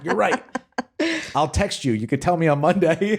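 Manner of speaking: high, laughing concession; amused acted retreat after being told off; sheepish mock backdown. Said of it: "And I'll be like".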